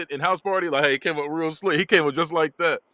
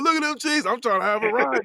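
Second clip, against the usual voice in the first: high, excited mock rant; going off; 10 semitones higher than usual. Friend going off while trying to have a ride, high and excited. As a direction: imitate him going off, pitched way up. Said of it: "So he's going off".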